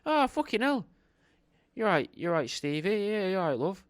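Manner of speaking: high, surprised bloke's greeting; a cheery lad spotting an old acquaintance; lightly voiced surprised bloke; man recognising her. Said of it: "the guy could go".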